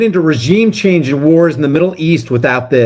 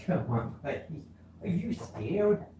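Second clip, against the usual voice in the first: much softer, slow and gentle; soothing dog talk; 21 dB quieter than usual. Real, not acted. Owner soothing his frightened Chihuahua, soft and slow. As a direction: coax the scared little dog softly.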